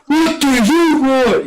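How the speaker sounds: high slow annoyed demand; irritated instructor. Retold or imitated. Drill instructor turning around, annoyed. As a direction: snap back with impatience.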